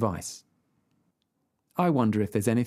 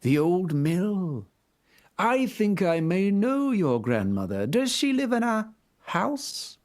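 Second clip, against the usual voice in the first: higher, slow sly wolf voice; 7 semitones higher, slower than usual. A narrator's reading. Wolf sweetly probing the girl, slow and sly. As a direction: draw it out like a scheming wolf.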